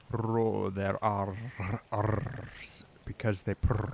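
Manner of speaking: slow purring rolled R on "roll their R, Rs" and "purr"; rolled r exercise. Shown as a demonstration. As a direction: roll the R in "R, Rs because they purr" slowly like a cat purring. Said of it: "cats speak for some reason always in this very deep, slow voice".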